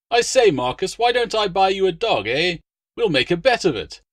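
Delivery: jovial legate. A narrator's reading. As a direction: cheerfully push the deal through. Legate teasing friend.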